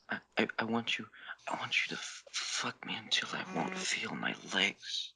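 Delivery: stammering, whispered confession; a shy lover forcing out a plea; ashamed whisper. Acted. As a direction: stumble through it in a hushed, embarrassed whisper. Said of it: "Haru cried, ashamed".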